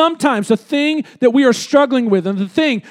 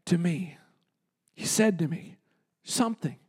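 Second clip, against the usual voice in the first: much softer, lower, almost breathed; hushed; 6 semitones lower, 13 dB quieter than usual. Acted. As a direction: draw the room in close. Dramatic hush before God's answer.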